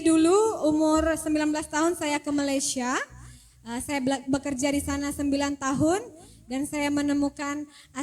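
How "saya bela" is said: usual speaking voice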